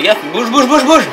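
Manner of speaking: high, loud urgent yelling; urgent shout. Real, not acted. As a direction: hurry them along loudly. Urging friend to run.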